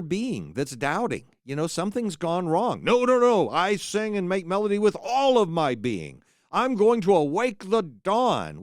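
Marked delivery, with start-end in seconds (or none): 2.8-8.6 defensive protestation. Acted. Doubter loudly insisting on faith.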